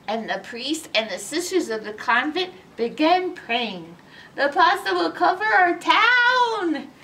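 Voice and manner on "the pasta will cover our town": higher, louder despairing cry; cried out. A narrator's reading. The townspeople cry out as the pasta spreads, loud cry. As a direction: wail it in alarm. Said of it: "they cried".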